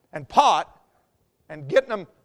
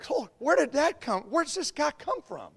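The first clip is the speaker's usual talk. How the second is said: baffled wonder; 5 semitones higher, 7 dB quieter than usual. Acted. Acts out baffled onlookers' reaction.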